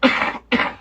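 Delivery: a harsh, breathy chest cough; real cough, rough and chesty. Real, not acted. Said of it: "Excuse me".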